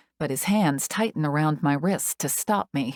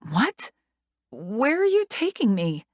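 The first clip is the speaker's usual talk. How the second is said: frightened; 7 semitones higher than usual. A narrator's reading.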